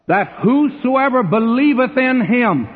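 high, ringing scripture recital; emphatic quoting. Acted. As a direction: proclaim the favorite verse with relish.

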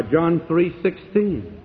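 ordinary delivery

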